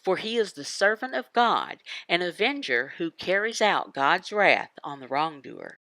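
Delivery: usual speaking voice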